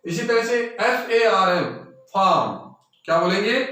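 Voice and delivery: usual speaking voice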